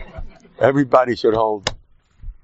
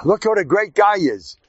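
mock boastful Haman; 8 semitones higher than usual. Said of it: "By him, Mahaman, it means".

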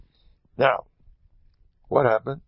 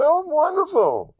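fake cheer; 4 dB louder than usual. Man pretends nothing happened to captain.

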